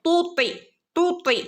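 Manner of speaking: high, full തൂത്യ; heavy ta. Shown as a demonstration. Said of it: "ഉയർത്തി പറയേണ്ട ലെറ്ററാണ് ത്വ". (ത്വ ഉയർത്തി തൂത്യ)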